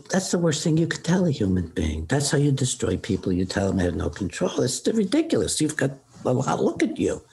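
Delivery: retold exasperated reassurance; indignant outburst. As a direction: protest it emphatically, pitch leaping up.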